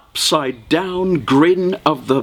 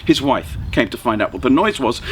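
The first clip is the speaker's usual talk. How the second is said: ordinary delivery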